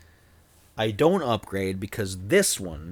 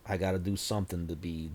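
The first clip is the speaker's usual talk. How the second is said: ordinary talk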